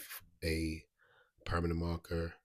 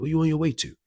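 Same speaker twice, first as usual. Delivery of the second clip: sudden high shouted question; imitated loud demand; 11 semitones higher, 13 dB louder, faster than usual. Gang member shaking up the storyteller, imitated loud demand.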